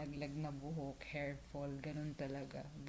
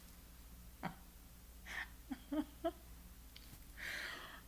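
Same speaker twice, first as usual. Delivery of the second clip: long high-pitched breathy laugh; 10 semitones higher, 9 dB quieter than usual. Real, not acted. Laughing hard at what the boss said. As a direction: laugh helplessly, high and airy.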